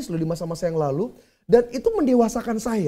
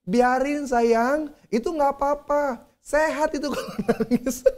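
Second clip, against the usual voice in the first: higher voice, starting with a slow drawn-out reassurance; imitated unbothered wife; 5 semitones higher, slower than usual. Wife telling panicked husband the crying is healthy, mock soothing. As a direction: play the wife soothing her husband, laugh creeping in. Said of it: "Istri saya bilang".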